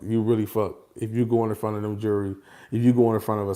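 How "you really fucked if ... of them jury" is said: normal talking voice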